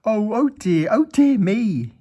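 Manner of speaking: high, loud wail; Mister Mean wailing over his potatoes. A narrator's reading. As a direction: wail it in despair. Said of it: "he wailed".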